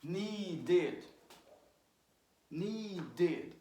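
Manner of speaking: "Needed," as a long NEE, then a clear DED; long vowel. Shown as a demonstration. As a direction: stretch the first syllable of "Needed,". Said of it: "this is like a long sound".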